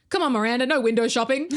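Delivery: hammed-up chivvying, a laugh creeping in; Hannah. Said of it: "Hannah goes".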